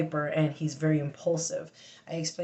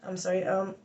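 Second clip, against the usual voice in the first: ordinary talk, nothing marked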